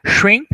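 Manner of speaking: "shrimp" said short and quick, the P barely heard; short English shrimp. Shown as a demonstration. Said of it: "um som bem simplesinho e rápido no fundo". ("shrimp" rápido, com o P bem leve no fundo)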